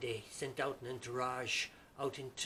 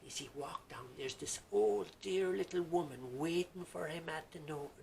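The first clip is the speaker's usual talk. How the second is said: ordinary delivery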